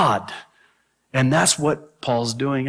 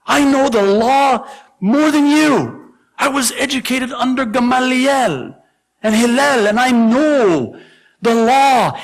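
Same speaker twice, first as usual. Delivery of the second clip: higher, louder, boastful ranting; pretend tirade; 9 semitones higher, 5 dB louder than usual. Paul's imagined rant at the crowd, loud boastful tirade. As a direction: rant like a proud scholar showing off. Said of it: "He could have went on a whole tirade".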